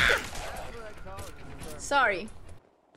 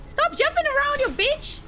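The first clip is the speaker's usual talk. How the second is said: frustrated yelling; 6 semitones higher than usual. Real, not acted. Fighting a jumping enemy.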